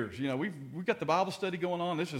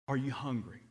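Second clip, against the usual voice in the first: ordinary talk, nothing marked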